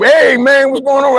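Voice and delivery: high-pitched, called-out hello; retold hearty greeting. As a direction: imitate an eager phone greeting. Voices the brother's greeting on phone.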